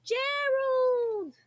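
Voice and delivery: high calling voice. Acted. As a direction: call his name from afar. Calling out to Gerald.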